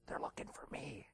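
put-on secretive child's whispered punchline; little kid's whisper. Little kid confesses to boss, secretive whisper. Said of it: "The little kid giggled".

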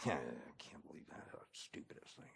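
whispered, very low grumble; murmuring. Acted. Employee reacting to the boss's new rule, murmuring. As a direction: mutter it under your breath, barely audible. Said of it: "I've gone from murmuring".

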